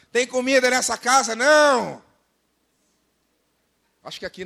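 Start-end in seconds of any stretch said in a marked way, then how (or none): 0.1-2.0 high, loud complaint yelled through the house; mock yell. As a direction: shout it like a grumbler at the fridge. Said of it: "e gritou lá".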